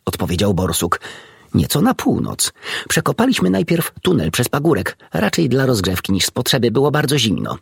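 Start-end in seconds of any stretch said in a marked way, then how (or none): 1.5-7.6 a lightly voiced badger recounting the dig; chatty badger. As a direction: badger voice, pitched higher. Badger tells of his digging.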